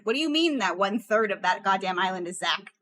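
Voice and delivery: ordinary talk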